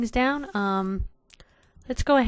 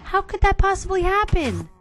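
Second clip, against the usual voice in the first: high, whiny cry of dismay; dismayed wail; 10 semitones higher, 4 dB louder than usual. Real, not acted. Gamer wailing to viewers over lost cart, high whine. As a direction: wail it like the world just ended.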